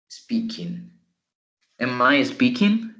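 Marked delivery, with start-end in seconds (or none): 1.8-2.9 spoken slowly for the class to follow; slow, clear question. Shown as a demonstration. Teacher quizzing the class, slow and clear question. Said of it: "It's a question".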